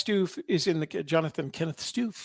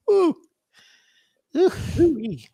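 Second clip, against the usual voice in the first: high, airy "ooh-wee"; amazed whoop; 13 semitones higher, 5 dB louder than usual. Real, not acted. Reacting to another doozy of a filing, amazed high ooh.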